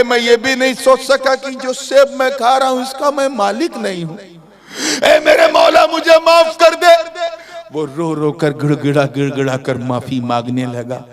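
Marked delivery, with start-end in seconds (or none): 5.0-6.9 high, loud cry to God for mercy; loud desperate plea for forgiveness; pleading cry. Acted. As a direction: beg aloud, voice raised high. Youth cries out to God.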